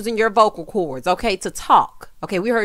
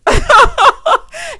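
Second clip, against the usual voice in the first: a real disbelieving cackle of laughter; hooting laugh at the absurd idea; loud high laugh; 10 dB louder than usual. Real, not acted. Laughs at "singers.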